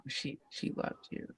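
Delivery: tears choke the words into a whisper; teary whisper. Retold or imitated. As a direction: let the words fall away into tears. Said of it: "So I start crying".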